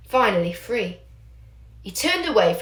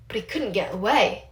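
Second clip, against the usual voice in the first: ordinary delivery, nothing marked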